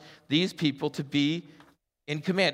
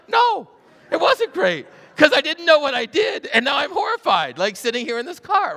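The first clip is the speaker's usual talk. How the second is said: high, loud indignant complaint; exasperated protest; 10 semitones higher, 5 dB louder than usual. Retold or imitated. Driver scolding his old school friends for the scare. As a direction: protest the joke, still rattled.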